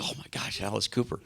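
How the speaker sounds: hushed, breathless whisper; stunned whisper. Retold or imitated. Fan whispering to [name] in the dark cinema, stunned whisper. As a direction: whisper it so the theatre can't hear.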